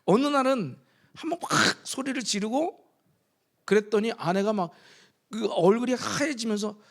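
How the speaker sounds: usual speaking voice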